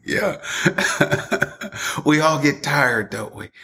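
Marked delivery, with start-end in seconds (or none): 0.4-2.0 short breathy chuckle at his own punchline; real laugh. Real, not acted. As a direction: laugh along with the joke.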